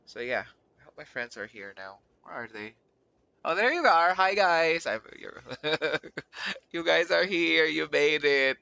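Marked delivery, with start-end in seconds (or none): 3.4-8.6 loud, high, delighted hello; excited greeting. Real, not acted. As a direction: greet your friends loudly and happily. Greeting friends who joined stream.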